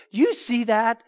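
normal talking voice

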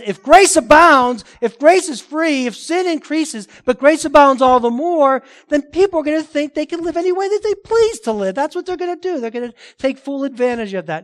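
high, loud, insistent legalist objection; imitating a legalist. Legalist objecting that free grace gets abused, high and loud. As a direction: voice a legalist's protest, pitched well up. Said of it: "But the legalist says".

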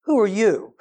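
mock-scornful challenge; who-do-you-think-you-are tone; confrontational challenge. Shown as a demonstration. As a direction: ask it as a challenge to their standing. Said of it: "The tone and the attitude is like".

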